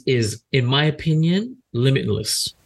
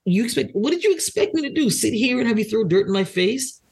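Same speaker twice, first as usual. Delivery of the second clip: heated mock complaint; 7 semitones higher than usual. Voicing the frustrated man.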